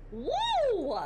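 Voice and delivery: loud, high "woo"; excited whoop. Real, not acted. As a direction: whoop it out at the steamy bit. Whoops at the steamy passage.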